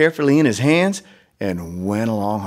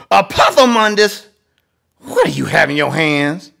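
lightly voiced scolding mama; 4 semitones higher, 4 dB louder than usual. Mama scolds her son.

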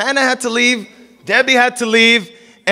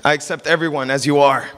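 mock gentle Jesus; 7 semitones lower than usual. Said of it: "sitting by a rock and a sheep saying".

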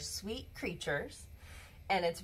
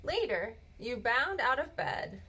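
ordinary delivery

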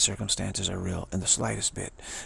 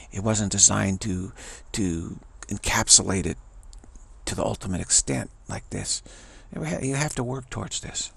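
usual speaking voice

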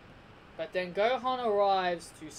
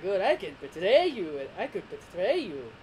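swooping villain dub voice; theatrical, taunting villain; Frieza; 4 dB louder than usual. Acted. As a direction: stretch and swoop on the threat. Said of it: "if you're reasoning why I'm doing that voice".

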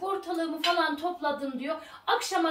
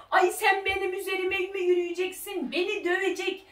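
high-pitched, wailing mother-in-law outcry; mock shrieking; 4 semitones higher than usual. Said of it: "Ciyak ciyak bağırıyor". (ciyak ciyak bağıran kaynana taklidi)

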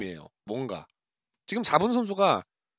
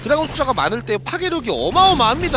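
loud, high-energy esports call; live play-by-play; 9 semitones higher, 7 dB louder than usual. Real, not acted. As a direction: shout the fight as it happens.

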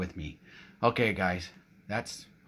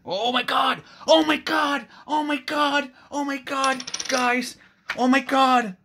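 much higher, louder shrieks of joy; real excited screaming; 8 dB louder than usual. Real, not acted. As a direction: scream it out over a huge win. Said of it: "You know I get excited".

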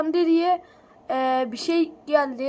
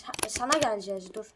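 ordinary talk

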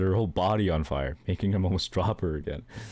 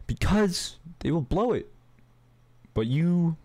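emphatic insistence; 10 semitones higher than usual. Acted. Hades dismissing his sons to his daughter, emphatic and high. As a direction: brush the idea off, pitched up.

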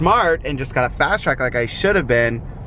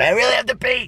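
urgent shout; 10 semitones higher than usual. Real, not acted. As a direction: blurt it out, desperate. Desperate to pee after long drive.

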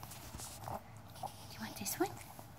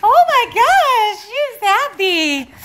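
higher, louder, slow, stretched-out delight; delighted gush; 8 semitones higher, 9 dB louder, slower than usual. Real, not acted. Owner gushing over her happy dog, slow high delight. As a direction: melt over how happy she is.

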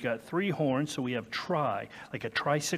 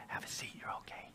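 whispering; 19 dB quieter, faster than usual. Real, not acted. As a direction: hush it to a whisper. Children settling down during sermon.

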